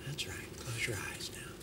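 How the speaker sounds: hushed whispered trance command. Shown as a demonstration. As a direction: breathe it out as a whisper, eyes closing. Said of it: "suddenly they went".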